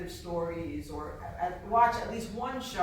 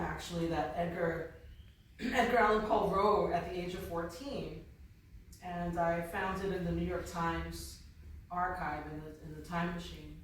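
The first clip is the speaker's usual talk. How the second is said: usual speaking voice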